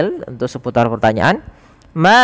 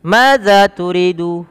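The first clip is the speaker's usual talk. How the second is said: slow model question; 5 semitones higher, much slower than usual. Shown as a demonstration. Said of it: "Pertanyaan selanjutnya".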